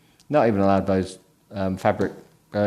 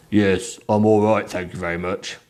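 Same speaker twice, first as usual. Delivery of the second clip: 1 indignant deep voice. Acted.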